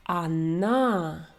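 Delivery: "Anna" opening on an A sound, stress on the last syllable; teacher's demo of "Anna", first vowel reduced to A; unstressed O as A. Shown as a demonstration. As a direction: model "Anna" slowly, second syllable stressed. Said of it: "O sounds like A".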